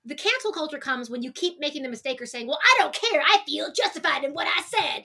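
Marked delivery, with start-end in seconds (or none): 2.5-5.1 defiant celebrity. Pretend. Mock unrepentant celebrity quote.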